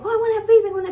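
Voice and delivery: high, loud, pleading mock voice; baby-obsessed whine. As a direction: whine it like you're desperate for a baby. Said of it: "because I can't be like".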